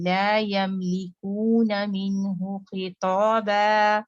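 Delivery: slow Quranic recitation, clear न in मिन. Shown as a demonstration. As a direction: recite slowly, sounding each letter. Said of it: "तो मिन यहाँ पे इजहार हो".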